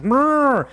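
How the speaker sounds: high, loud angry creature rumble; loud catfish growl. Acted. Catfish roaring as the cowboy kicks it. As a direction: roar like a thrashing beast.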